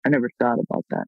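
ordinary talk

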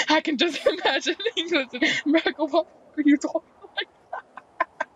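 real laughter breaking up the words; laughing while talking. Real, not acted. Imagining England and America talking, laughing. Cracking up at the acted voices.

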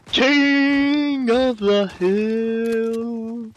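very slow, stretched-out KING of the hill; drawn-out echo. Pretend. Player echoing the game's goal, KING long drawn out. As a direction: hold KING long and draw out the rest.

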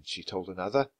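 ordinary delivery

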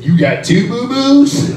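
high, slow stunned question; hearing that the cousin has two boyfriends, a high, slow, shocked question; shocked disbelief. Retold or imitated. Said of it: "I said".

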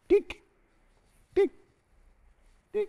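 sharp high chisel taps; high chisel dink. Retold or imitated. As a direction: tap it out like a chisel on stone. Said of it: "And he goes".

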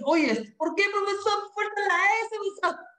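put-on high, piping child's question; child asking. A pupil asks the teacher why, in a high child's voice. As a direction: squeak it like a curious pupil.

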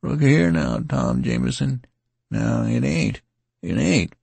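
calling after a departing friend; mildly bleating plea; plaintive bleating. A narrator's reading. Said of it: "bleating plaintively".

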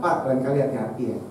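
soft, gentle word to the driver; calm polite reproach. Shown as a demonstration. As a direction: say it softly, no fuss.

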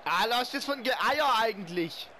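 loud groan. Real, not acted. Player reacting loudly.

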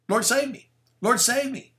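high-pitched mock cry for help; plea for rescue. Preacher models calling to Jesus when sinking, high pleading. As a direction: call out a short, high plea to be saved.

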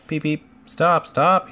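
high, loud alarmed whistle call; whistling engine. A narrator's reading. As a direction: plead to stop like a shrieking train whistle. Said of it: "he whistled".

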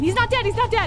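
relieved shout. Real, not acted. Relief teammate survived.

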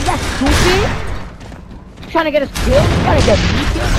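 high, loud furious outburst; angry yelling. Real, not acted. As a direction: yell at a careless teammate. Yelling at teammate in game.

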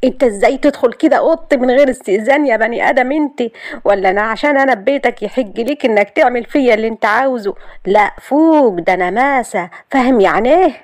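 high, loud furious outburst at him; bursting out at her cousin for walking into her room; exploding in anger. Acted. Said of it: "وهي انفجرت فيه".

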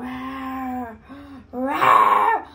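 drawn-out wowed cry; amazed. Real, not acted. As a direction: marvel at the candies. Sees skull candies.

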